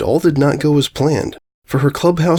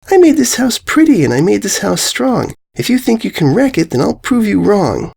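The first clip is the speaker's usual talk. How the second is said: put-on much higher, indignant young filly; proud filly; 10 semitones higher than usual. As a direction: stand up for her tent. Filly defends her house.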